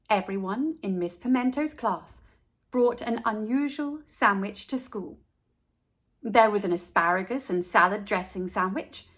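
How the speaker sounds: usual speaking voice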